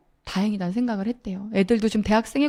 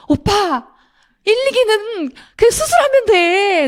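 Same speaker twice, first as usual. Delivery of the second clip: much higher and louder, slow and emphatic "just get the surgery"; big slow insistent reassurance to a panicking brother; 11 semitones higher, 5 dB louder, slower than usual. Retold or imitated. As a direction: call out over his crying to calm him. Firmly calming her sobbing brother.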